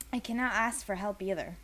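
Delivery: ordinary delivery, nothing marked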